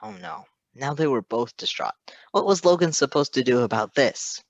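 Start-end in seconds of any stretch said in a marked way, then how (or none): none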